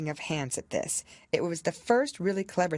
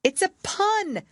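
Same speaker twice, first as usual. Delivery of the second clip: much higher, louder, slow huffy; offended king; 6 dB louder, slower than usual. A narrator's reading. King explaining his pun to the unlaughing court, offended. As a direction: say it huffily, offended. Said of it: "the king added in an offended tone".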